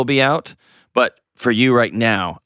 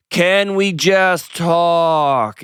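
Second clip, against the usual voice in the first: high, hugely stretched-out imitation; mock slow talking; 7 semitones higher, much slower than usual. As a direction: drag each word out for someone who can't understand. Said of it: "they just talk English really slow and really loud".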